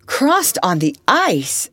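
slow, loud, incredulous echo; astonished exclamation. A narrator's reading. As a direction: exclaim it slowly, stretching ICE in amazement. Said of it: "exclaimed everyone".

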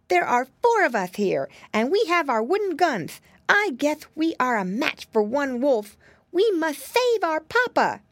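high determined piglet voice; rally the troops; little pig Flop, brave. Acted. Flop rallies the others.

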